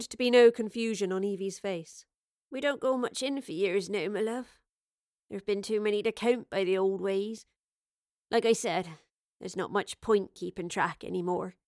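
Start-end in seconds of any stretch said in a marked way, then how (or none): 2.5-11.6 a hint of a homely, rustic woman; lightly voiced Evie. Host answering what year it is, lightly voiced homely woman. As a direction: lightly play a gentle, resigned host.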